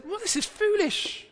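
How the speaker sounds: high, slow mock disbelief; lightly acted incredulity; incredulous onlookers. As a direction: voice the baffled onlookers. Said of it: "they would say".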